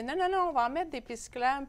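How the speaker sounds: playful quote of the mayor's insistence; quoting the mayor. Retold or imitated. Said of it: "elle l'a pas dit comme ça".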